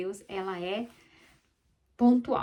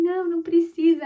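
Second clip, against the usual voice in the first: high, loud hurried no; girl refusing her father's offer of a spanking; quick childish refusal; 7 semitones higher, 9 dB louder than usual. Retold or imitated. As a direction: blurt it like a startled little girl.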